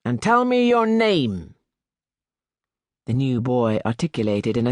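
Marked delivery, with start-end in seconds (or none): 0.0-1.5 high, stern teacher's demand; the master questions the new boy; stern high schoolmaster; schoolmaster's demand. A narrator's reading. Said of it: "repeated the master".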